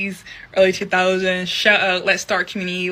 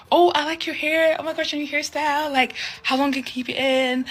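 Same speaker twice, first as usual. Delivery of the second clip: higher, gushing curious voice; higher, mock gushing curiosity; nosy coworker; 8 semitones higher than usual. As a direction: mimic a fascinated colleague. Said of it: "people are like".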